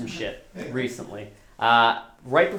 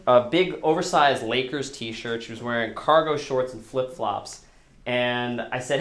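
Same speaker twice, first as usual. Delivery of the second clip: ordinary talk, nothing marked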